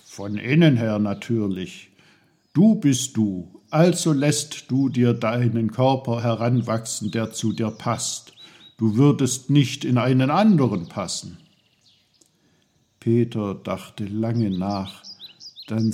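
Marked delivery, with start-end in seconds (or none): 0.1-11.4 loud, emphatic lecturing uncle; self-important Uncle Pfefferkorn. Acted. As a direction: explain proudly with forceful emphasis.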